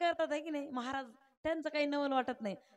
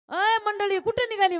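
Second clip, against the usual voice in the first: calling out; 7 semitones higher, 10 dB louder than usual. Retold or imitated. Worker calling after departing group.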